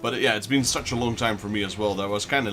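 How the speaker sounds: usual speaking voice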